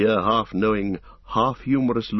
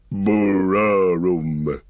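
long, drawn-out rumble like a discord on a great organ; Treebeard's droning, organ-like rumble; deep rumbling noise; 5 dB louder, much slower than usual. A narrator's reading. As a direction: rumble the word out very slowly. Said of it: "He made a deep rumbling noise like a discord".

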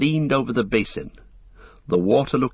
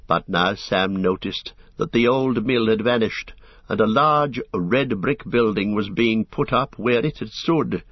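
plain reading voice

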